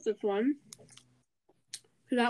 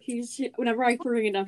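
normal talking voice

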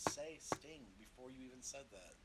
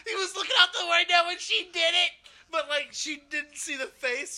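much higher and louder, excited; excited shouting; 17 dB louder than usual. Real, not acted. Excited loud storytelling.

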